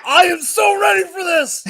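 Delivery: loud and high, fired up; excited shout. Real, not acted. Cohost answering the host, loud, excited shout. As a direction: yell that you're ready. Guest answers host's hype question.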